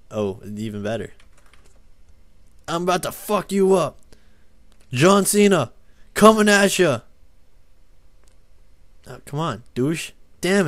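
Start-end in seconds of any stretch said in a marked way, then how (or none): 2.7-7.0 high, loud mock wrestling taunt; hyped-up smackdown boast; wrestler trash talk. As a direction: shout the threat like a wrestler charging in.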